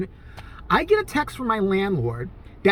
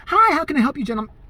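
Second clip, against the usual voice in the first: mock waitress greeting; 7 semitones higher, 4 dB louder, faster than usual. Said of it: "She did one of these numbers".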